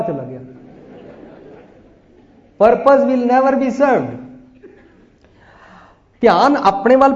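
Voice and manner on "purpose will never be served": ordinary talk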